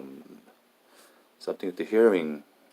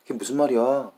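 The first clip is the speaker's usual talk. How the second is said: high, louder Korean intonation; native Korean model phrase; 7 semitones higher, 6 dB louder, much faster than usual. Shown as a demonstration. Earpiece telling a Chinese learner the line, high Korean intonation. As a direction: give the exact Korean tune, pitched up. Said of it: "the earpiece tells me".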